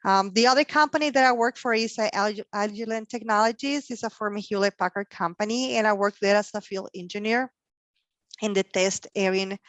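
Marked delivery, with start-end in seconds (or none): none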